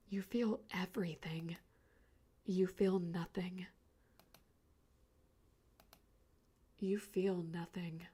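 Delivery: slow, fading whisper. A narrator's reading. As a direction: let it die into a whisper. Fading end of the story.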